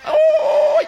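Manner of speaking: very high, loud "oh"; exultant whoop. Real, not acted. Preacher rejoicing at the granted deal, exultant whoop. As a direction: whoop it out at the top of your voice.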